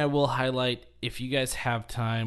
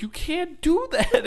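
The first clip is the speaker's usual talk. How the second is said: high-pitched mock protest with laughter; objecting host; 14 semitones higher, 3 dB louder than usual. Host objects to the phone grab, high mock protest. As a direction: squeak it out like a scandalized host. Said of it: "the host is like".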